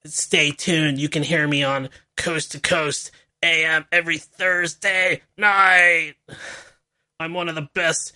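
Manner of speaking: slow, scratchy mock radio-host voice; a hammed-up scratchy, slow paranormal-researcher drawl; mocking Linda Moulton Howe. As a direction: drag out each word like a spooky late-night UFO host. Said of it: "I don't know why I'm making fun of Linda Moulton Howe".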